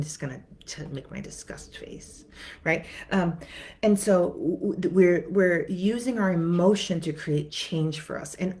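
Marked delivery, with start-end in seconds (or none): 0.0-2.2 softer, unvoiced aside; whispered aside. Real, not acted. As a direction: drop to a whisper. Aside while pulling a face.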